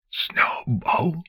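barely breathed name, no sound; voiceless whisper. Acted. Father mouthing his lost daughter's name in a whisper. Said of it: "no sound escaped his lips".

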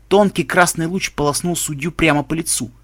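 usual speaking voice

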